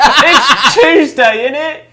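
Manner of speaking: slow, high, mock British accent; hammed-up British accent with a laugh. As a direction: play a cheeky Londoner, British accent. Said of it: "Say something in English".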